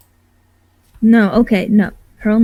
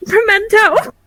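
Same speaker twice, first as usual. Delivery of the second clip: high, sudden character squeal; in-character outburst; 11 semitones higher, slower than usual. Acted. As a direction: blurt it out as your quirky character. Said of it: "in character for my, uh, character to say".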